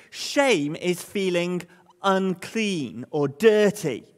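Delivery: each word set apart slowly; "Shame is feeling unclean or dirty" spelled out slowly for the congregation; slow, deliberate definition. Shown as a demonstration. Preacher stating his first point slowly. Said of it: "slow emphatic statement of the point".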